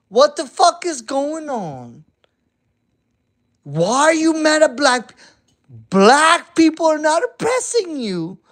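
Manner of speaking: high, loud exasperated ranting; heated rant. Real, not acted. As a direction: blow up at the whole situation. Rising furious rant.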